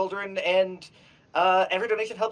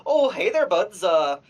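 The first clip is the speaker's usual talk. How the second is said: hammed-up Canadian hoser accent, lively and a little louder; chummy Canadian accent, apologizing to the buds; 2 semitones higher, 3 dB louder than usual. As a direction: play a folksy Canadian dragon.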